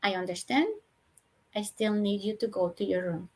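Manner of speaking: low, quiet and steady; calm and unmoved, just repeat it; soft firm repeat. Shown as a demonstration. Mother repeating the calm instruction to her daughter. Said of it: "So very soft, very firm".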